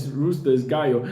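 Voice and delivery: normal talking voice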